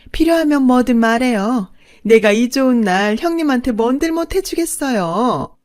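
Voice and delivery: high, loud gushing delight; gleeful second wife. Acted. Second wife offering rings to the first wife, overjoyed. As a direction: gush with patronising generosity. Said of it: "뛸 듯이 기뻐했어요".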